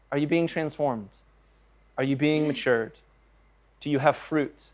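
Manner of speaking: ordinary talk, nothing marked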